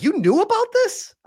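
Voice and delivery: high accusing wife voice; imitating wife's accusation.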